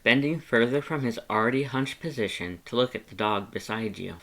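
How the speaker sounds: usual speaking voice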